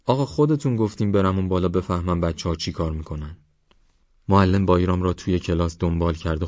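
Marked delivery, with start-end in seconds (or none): none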